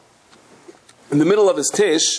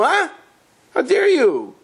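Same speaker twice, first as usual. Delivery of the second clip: mock outrage; 14 semitones higher, slower than usual. Crowd's imagined outrage at guest.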